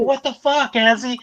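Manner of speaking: very high startled outburst; comic high-pitched disbelief; retold shock. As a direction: act out being ambushed by the cat. Retells cat jumping on face.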